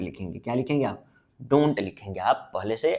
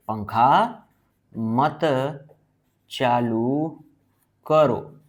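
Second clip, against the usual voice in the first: पंखा, मत, चालू, करो spaced out with long pauses; word-by-word dictation; 3 dB louder, much slower than usual. Shown as a demonstration. Dictates it while writing it out.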